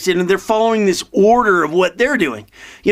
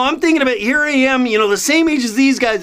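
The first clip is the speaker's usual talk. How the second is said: ordinary delivery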